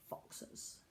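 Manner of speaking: soft, breathless whisper; whispered aside. A narrator's reading. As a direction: whisper the last word as a sly joke. Whispered punchline.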